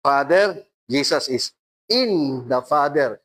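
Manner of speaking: ordinary delivery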